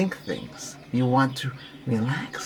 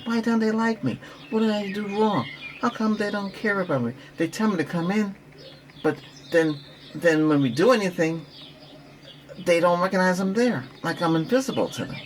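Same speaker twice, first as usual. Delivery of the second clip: higher-pitched, lightly plaintive questioning; mock hurt complaint; 6 semitones higher than usual. Coach voicing someone left out by friends, mildly wounded.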